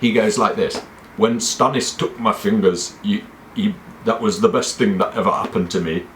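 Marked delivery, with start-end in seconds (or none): 1.2-6.0 hammed-up Geordie accent; stagey drama-student Geordie; theatre school Geordie. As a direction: put on a badly faked Geordie accent. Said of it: "theatre school Geordie accent".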